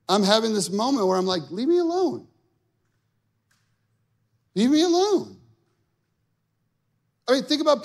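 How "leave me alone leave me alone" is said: much higher, whiny mock protest; fed-up plea with a long pause before repeating; exasperated. Frustrated golfer telling the Lord to back off, whiny.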